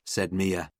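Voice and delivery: ordinary delivery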